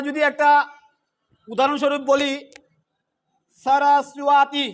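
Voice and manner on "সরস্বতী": সরস্বতী drawn out slowly, syllable by syllable; teacher's slow model of সরস্বতী with s, not sh; Sanskrit pronunciation demo. Shown as a demonstration. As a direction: say সরস্বতী as sa-ra-su-a-ti, crisp s. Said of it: "চর মতো উচ্চারণ হচ্ছে".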